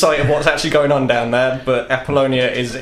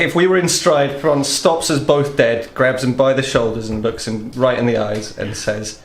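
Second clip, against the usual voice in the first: usual speaking voice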